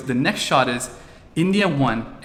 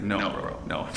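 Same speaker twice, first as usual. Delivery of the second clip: quieter, lower secret no; hiding his real loyalty from his son, hushed; inner voice; 6 semitones lower, 9 dB quieter, slower than usual. Retold or imitated. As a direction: mutter the true feelings under his breath. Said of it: "But inside it's like".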